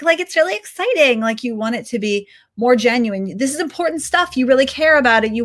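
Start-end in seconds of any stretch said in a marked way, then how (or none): 0.0-1.2 forced, higher-pitched mock enthusiasm; fake excitement. Shown as a demonstration. Teacher showing how not to read scripture, fake excitement. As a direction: sound fake-excited on purpose. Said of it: "you're reading it and you're fake".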